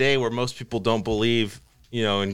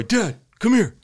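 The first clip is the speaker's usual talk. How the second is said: put-on calling child; 8 semitones higher than usual. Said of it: "He called me from the hallway".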